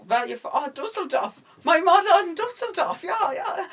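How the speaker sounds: very high-pitched mock German accent; Fassbender's German act. Retelling the bar scene for laughs, mock German accent. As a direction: squeal it in a put-on German accent. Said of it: "he's talking, he's like".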